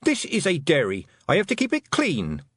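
high, cross scolding; angry. A narrator's reading. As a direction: tell them off indignantly. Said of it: "shaking with anger".

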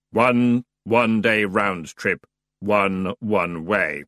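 slow, level boatman voice; dry fare-counting; dour ferryman. Acted. The ferryman itemises fares, dry.

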